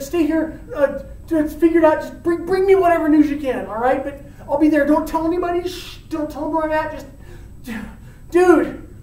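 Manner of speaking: high, hurried, stumbling urgency; acted frantic instructions. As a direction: swear a friend to secrecy in haste. Romeo hurriedly instructing friend.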